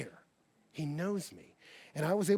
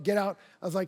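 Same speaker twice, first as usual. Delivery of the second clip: ordinary talk, nothing marked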